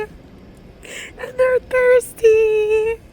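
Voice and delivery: real high, loud, drawn-out wail; crying in distress. Real, not acted. Distraught adult pleading for hungry, thirsty sick kids, crying loudly. Breaking down over sick waiting children.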